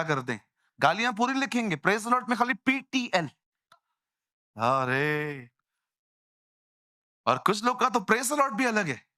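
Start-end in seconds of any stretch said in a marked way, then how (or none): none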